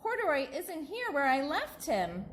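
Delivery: high, lightly tearful call; crying out to Mommy. Acted. Child Lisa voiced in story.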